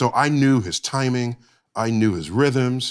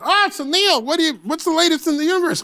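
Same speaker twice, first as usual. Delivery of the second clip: high-pitched, hammed-up talk-show host impression; terrible Leno; 13 semitones higher than usual. Astrophysicist mimics the host greeting him, bad high-pitched impression. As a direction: badly imitate a host's high, quick patter. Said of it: "That's a terrible Leno impression".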